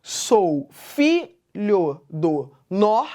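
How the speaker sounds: "Sou filho do nor" very slowly, stressing "fi" and "nor"; "Sou filho do nor" in separate beats, strong on "fi" and "nor"; weak-strong scansion. Shown as a demonstration. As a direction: split "Sou filho do nor" by syllable and lean on "fi" and "nor". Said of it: "esse padrão de fraca, forte".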